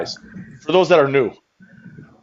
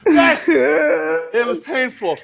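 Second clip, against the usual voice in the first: high, strained tearful cry; mock anguish; 10 semitones higher, much slower than usual. Confessing how painful it was, mock anguish. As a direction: wail it slowly through fake tears. End of the fake crying fit.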